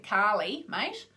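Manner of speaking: hammed-up Aussie accent with rising "mate"; Australian accent. Shown as a demonstration. Contrasting with Indian pronunciation, broad Australian accent on "Carly". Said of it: "in my Australian accent".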